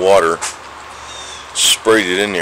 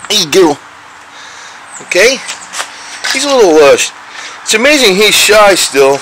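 pet talk; 10 semitones higher, 6 dB louder than usual. Real, not acted. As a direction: gush up high at the shy cat. Cooing over the cat.

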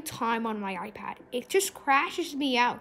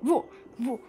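usual speaking voice

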